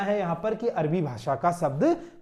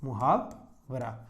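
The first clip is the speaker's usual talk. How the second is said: मुहावरा drawn out syllable by syllable, lower and softer; slow spelled-out word; 6 semitones lower, 6 dB quieter, much slower than usual. Shown as a demonstration. Teacher dictating मुहावरा to students, very slowly. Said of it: "अरबी शब्दों में मुहावरा".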